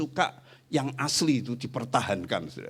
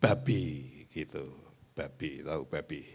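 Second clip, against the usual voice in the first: lower, softer pet name; low, soft teasing; 8 semitones lower, 7 dB quieter than usual. Retold or imitated. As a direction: murmur the nickname playfully. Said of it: "kamu anu aja". (berbisik manja)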